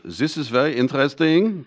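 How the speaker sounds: lightly mimicking a German investor; bad German accent. Investor quoting a German PhD's note, in a mock German accent. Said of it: "Trying to do my bad German accent".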